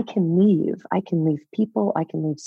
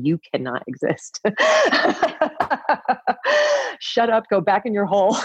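higher, laughing through a mock scold; mocking harsh voice, laughing; 7 semitones higher than usual. Scolding her inner self through laughter. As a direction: bark it out while laughing at yourself. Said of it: "I was suffocating her, saying".

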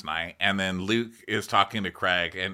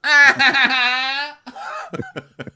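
high, loud cackle; loud laugh; 9 dB louder than usual. Real, not acted. Cracking up at the frozen Wi-Fi line, loud laughter. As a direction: burst out in a long high laugh.

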